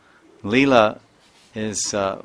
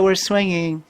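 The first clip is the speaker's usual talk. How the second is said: put-on high-pitched, louder little kid answering; imitated child; 10 semitones higher, 5 dB louder than usual. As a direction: pipe up like a kid at play. Voicing swinging child's answer.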